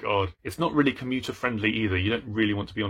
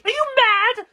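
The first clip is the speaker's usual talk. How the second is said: very high, loud screech; mock outrage; 7 dB louder than usual. Reacting to someone not wanting happiness, mock outraged screech. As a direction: shriek it incredulously. Acted retort in imagined exchange.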